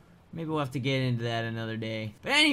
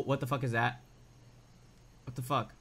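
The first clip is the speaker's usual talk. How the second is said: ordinary talk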